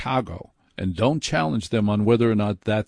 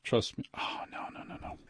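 hushed off-mic whisper of dismay; whispered muttering; 14 dB quieter than usual. Real, not acted. Host fumbling the phone system, whispering to himself. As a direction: whisper to yourself as it goes wrong. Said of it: "fumbling off mic".

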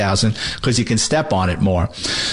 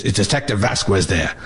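lightly imitated gravelly caller, lower pitched; a low, lightly gravelled phone voice; 4 semitones lower than usual. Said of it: "with this gravelly voice".

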